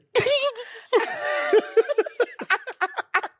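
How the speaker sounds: high, long giddy squeal of laughter. Real, not acted. Recalling running to her mom, long high giddy laugh. Reliving meeting mom after.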